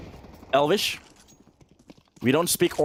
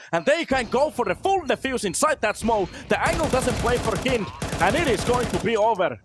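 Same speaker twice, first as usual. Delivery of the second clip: live play-by-play; 5 semitones higher than usual. Acted. Caster calling the round for the stream, rising excitement. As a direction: call the round as it explodes.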